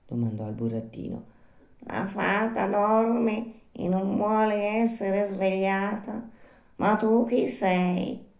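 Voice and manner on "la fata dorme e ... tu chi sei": high, drawn-out little snail voice; slow snail. Acted. Snail calling down to a night caller, slow snail voice. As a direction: stretch each word like an unhurried snail.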